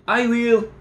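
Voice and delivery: high, eager yell; boy shouting to claim the bundle. Acted. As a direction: jump in ahead of your brother. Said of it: "Pete yelled".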